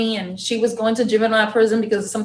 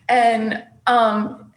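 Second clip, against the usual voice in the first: ordinary talk, nothing marked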